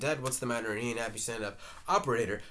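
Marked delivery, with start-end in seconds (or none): none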